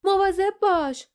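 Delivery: high, loud yell of alarm; warning shout. A narrator's reading. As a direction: yell a sudden warning to a companion. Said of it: "فریاد زد".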